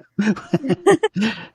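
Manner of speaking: a short bright laugh; real amused laugh. Real, not acted. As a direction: laugh at the memory. Laughing at the memory.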